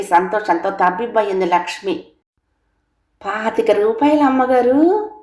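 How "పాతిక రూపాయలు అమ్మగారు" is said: happy maid. A narrator's reading. Maid answers happily, slow.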